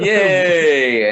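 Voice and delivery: high, loud cheer; drunken whoop. Retold or imitated. Imitating his drunk holiday self cheering loudly. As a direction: cheer like you're drunk at a party. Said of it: "I just keep fucking whooping".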